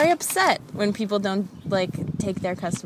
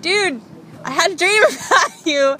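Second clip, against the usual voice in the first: laughing confession; 10 semitones higher, 7 dB louder than usual. Retold or imitated. Confessing dream to Steve, laughing.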